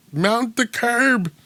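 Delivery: mock a British actor playing a cop; Brit's American cop voice. Host mocking the line, slow and high. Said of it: "written by someone British trying to sound like an American cop".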